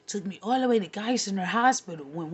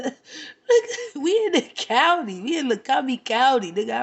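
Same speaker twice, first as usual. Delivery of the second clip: much higher, laughing through the words; laughing retort; 3 semitones higher, 6 dB louder than usual. Real, not acted. Laughing at his cowboy line, high and breaking up. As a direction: crack up mid-retort.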